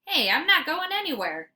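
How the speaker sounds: mild dog protest; puppy protest. A narrator's reading. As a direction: protest cheerfully. Fenway protesting.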